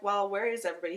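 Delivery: ordinary delivery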